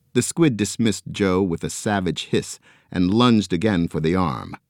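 ordinary delivery

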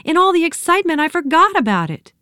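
put-on very high excited girl. A narrator's reading. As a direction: gush it breathlessly. Nancy still excited.

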